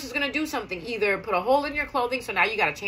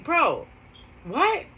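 shocked disbelief; 4 semitones higher than usual. Retold or imitated. Hears shoe fell into falls.

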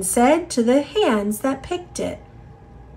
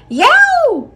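high yowl of a scared cat, louder; startled cat yowl; 7 dB louder than usual. Acted. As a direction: squeal like a spooked cat. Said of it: "said the cat".

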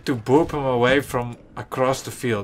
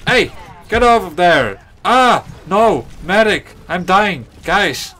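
loud, high cries for help; panicked shout; 6 semitones higher, 7 dB louder than usual. Real, not acted. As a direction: yell for the medic while dying. Getting killed, calling for medic.

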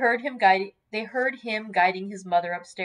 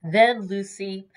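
ordinary delivery, nothing marked

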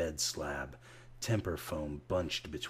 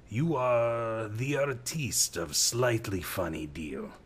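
gruff barman Rats; 5 semitones higher, 4 dB louder than usual. A narrator's reading. Rats, barman, teases Case.

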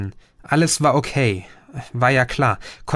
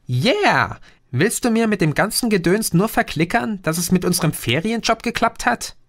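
put-on higher, excited teenage girl; cheering; 8 semitones higher than usual. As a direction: jump up and cheer, then tease. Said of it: "Chrissy springt auf und jubelt". (jubelnd, höher, aufgeregt)

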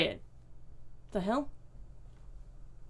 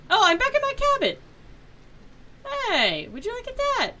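surprised delight; 12 semitones higher than usual. Real, not acted. Surprised to be back in cabin.

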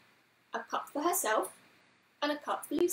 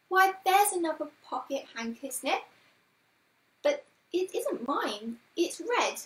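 put-on higher, louder child character; puzzled Lucy; 5 semitones higher, 4 dB louder than usual. A narrator's reading. Lucy puzzling over a red handkerchief, high puzzled voice. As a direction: wonder aloud with a high lilt.

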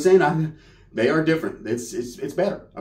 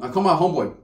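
ordinary talk

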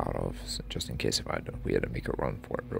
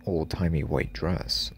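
ordinary delivery

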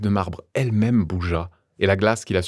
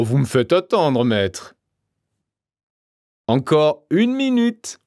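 hammed-up triumphant farewell; exclaiming in triumph; 5 semitones higher than usual. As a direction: taunt the absent detective with gleeful bravado. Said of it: "s'écria".

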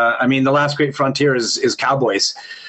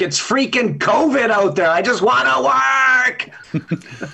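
higher, building to a slow shout; desperate plea; 8 semitones higher than usual. Real, not acted. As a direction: beg for work, rising to a shout. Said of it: "pleading for acting work".

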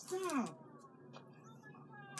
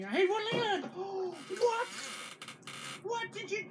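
shocked outcry; 8 semitones higher than usual. Real, not acted. Mom sees son throw pizza.

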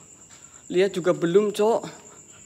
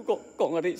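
ordinary talk, nothing marked

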